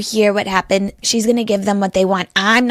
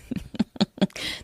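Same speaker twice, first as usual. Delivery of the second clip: breathy laugh; 10 dB quieter than usual. Real, not acted. Laugh at self.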